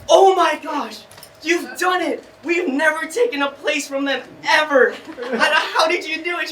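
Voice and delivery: high, loud gushing teacher voice, near tears; overjoyed teacher. Retold or imitated. Teacher cheering his win, high and gushing. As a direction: gush like a thrilled teacher. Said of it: "she goes".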